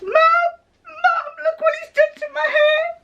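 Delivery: put-on high, wailing teenage daughter, tearful shout; crying daughter. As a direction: cry out to mum in despair over the hair. Daughter crying about ruined haircut.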